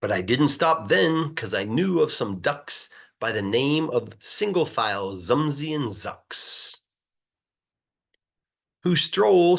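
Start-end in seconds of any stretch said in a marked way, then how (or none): none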